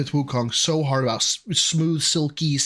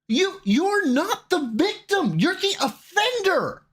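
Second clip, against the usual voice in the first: high, slow, hammered-out rebuke; outraged high-pitched scolding; indignant accusation; slower than usual. Real, not acted. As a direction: drive each word home way up high. Angry retort to the clip.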